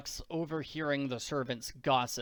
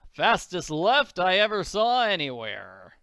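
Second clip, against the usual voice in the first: loud, boastful father; excited; 3 semitones higher, 8 dB louder than usual. Acted. Father clapping the girl on the shoulder, excited. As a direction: crow about it at high volume. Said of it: "Daddy may be excited".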